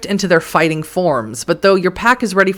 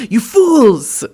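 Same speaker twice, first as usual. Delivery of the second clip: cartoon villain, higher and louder; 6 semitones higher, 4 dB louder than usual. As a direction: play it like Snidely Whiplash. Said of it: "he says like a villain".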